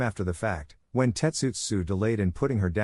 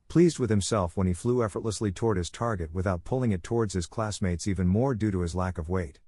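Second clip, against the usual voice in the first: plain reading voice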